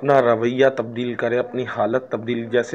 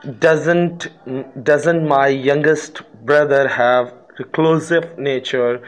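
slow reading aloud; much slower than usual. Shown as a demonstration. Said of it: "reads negative question sentence".

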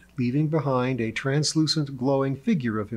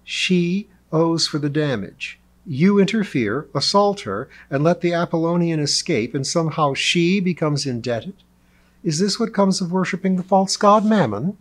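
raised, louder scandalised questioning; incredulous indignation; 6 semitones higher, 5 dB louder than usual. A narrator's reading. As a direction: throw the accusation back in disbelief. Lee incredulous at his demand.

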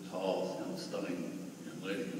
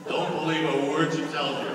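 louder, mock-warning joke; joking; 10 semitones higher, 13 dB louder than usual. Old man jokes about the grandmother, louder. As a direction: warn her with a grin.

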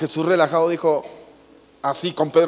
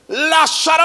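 very high, loud drawn-out call; over-the-top miracle cry; booming dramatic shout; 12 semitones higher, 7 dB louder, slower than usual. Acted. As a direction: bellow the name as if raising the dead. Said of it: "siempre pensamos que".

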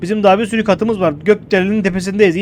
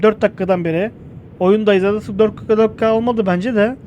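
ordinary delivery

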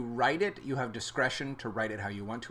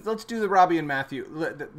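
ordinary delivery

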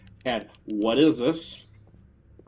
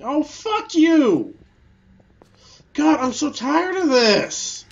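furious shout; 12 semitones higher, 10 dB louder than usual. Real, not acted. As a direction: scream your rage at the game. Raging at the game.

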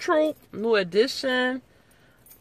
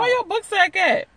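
scolding; 11 semitones higher, 4 dB louder than usual. Real, not acted. Scolding the kid.

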